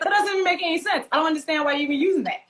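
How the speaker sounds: high, rapid retold objection; mock baffled protest. Child corrects her teasers' illogical jokes, mock baffled protest. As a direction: rattle off the complaint in amused disbelief.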